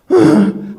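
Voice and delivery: loud mock disgusted sigh; dog's sigh. The dog sighing at him, loud mock sigh.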